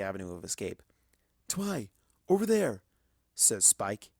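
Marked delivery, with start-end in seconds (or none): 1.5-2.8 Spike's voice. A narrator's reading. Spike points out an alleyway.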